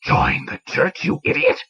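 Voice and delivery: put-on old priest exploding at the young man; half-hissed then a high shout. A narrator's reading. Priest to confessing young man, an exasperated outburst. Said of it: "shouted the old man".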